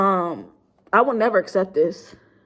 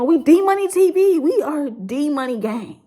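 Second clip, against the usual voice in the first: channel hype; 6 semitones higher, slower than usual. Real, not acted. Rallying his subscribers with a hyped channel shout-out. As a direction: pump up the D-Money gang name.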